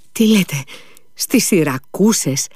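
a confused father crying out to the doctor; bewildered cry. A narrator's reading. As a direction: cry out, not understanding. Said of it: "φώναξε ο λοχαγός".

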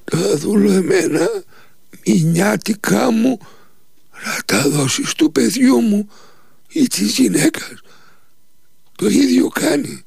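high, slow, strained and tearful; whimpering dying voice. Acted. Dying Nikita asks master to pay his wages to his family, whimpering. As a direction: plead faintly, every word an effort. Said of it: "με κλαψιάρικη φωνή".

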